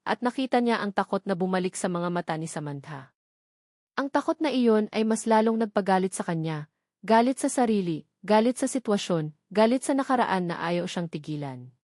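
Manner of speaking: ordinary delivery, nothing marked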